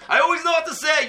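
the master speaker throws up his hands; half-shouted I always know what to say; emphatic admission. Retold or imitated. Rebbe admits he is at a loss.

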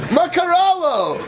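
high slow laughing cry; drawn-out high laughing call; laughing mock exclamation. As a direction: burst out in amused disbelief.

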